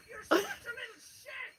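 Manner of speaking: laughing at the little shit; laughing scold. Real, not acted. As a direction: scold him through a laugh. Laughing at Billy.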